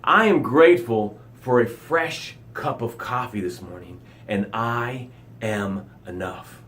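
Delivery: a soft spoken gratitude demo for the tribe; softly grateful. Shown as a demonstration. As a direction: say it quietly, like a private thank-you. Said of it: "This is how it sounds".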